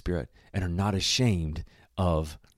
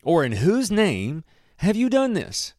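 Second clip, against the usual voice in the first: slow, drawn-out interrogation; demanding; 6 semitones higher, slower than usual. A narrator's reading. Council questioning the two disciples, demanding. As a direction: demand an answer, rising higher on the second question. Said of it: "and demanded".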